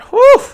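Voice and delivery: sharp high "whew" of dread; high whistle-like whew. Real, not acted. Fan dreading the pass rush he'll face. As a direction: blow out a worried whew. Dreading Aaron Donald and Von Miller.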